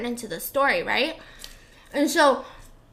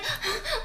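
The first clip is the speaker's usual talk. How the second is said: mock dramatic gasp; 12 semitones higher, 7 dB quieter than usual. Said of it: "Yeah, and like".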